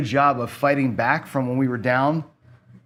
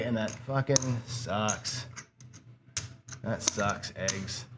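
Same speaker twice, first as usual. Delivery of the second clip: much softer and slow, grumbling under the breath; muttered disgust; 4 semitones lower, 10 dB quieter, much slower than usual. Real, not acted. Down five hundred at craps, a soft, slow disgusted mutter. Fed up after losing session.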